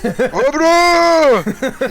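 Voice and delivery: long high yell, laughing; Rocky Adrian yell. Retold or imitated. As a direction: stretch it out like Rocky. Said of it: "like he's saying Adrian".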